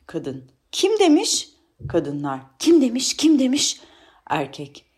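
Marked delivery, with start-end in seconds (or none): 0.7-1.5 very high, loud outcry; outraged woman disputing a claim; indignant challenge. Acted. 2.6-3.8 high, loud repeated protest; outraged women clamouring; indignant chorus. Acted.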